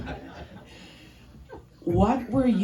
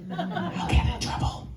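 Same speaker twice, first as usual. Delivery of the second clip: normal talking voice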